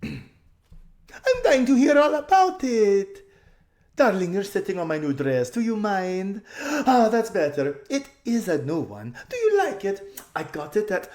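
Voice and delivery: very high, loud, slow and laughing; thrilled spoiled socialite; gushing society lady. A narrator's reading. Lasaraline chattering.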